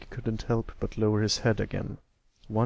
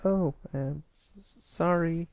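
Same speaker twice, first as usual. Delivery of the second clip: embarrassed stammer; 7 semitones higher, slower than usual. Acted.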